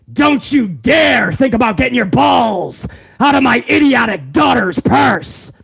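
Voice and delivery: bossy father-in-law shouting orders; Vince McMahon impression. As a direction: bark at the son-in-law like a tyrant.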